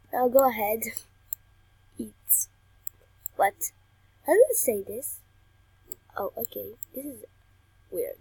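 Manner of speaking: usual speaking voice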